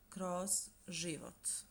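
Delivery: kroz život said slowly, syllable by syllable; slow model phrase. Shown as a demonstration. Teacher modelling a new phrase for students, slow and deliberate. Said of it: "teacher models new expression slowly".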